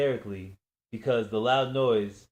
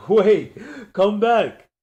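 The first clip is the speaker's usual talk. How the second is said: laughing; 10 semitones higher, 12 dB louder, much slower than usual. Acted. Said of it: "still laughing as he spoke".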